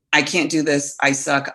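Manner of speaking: ordinary talk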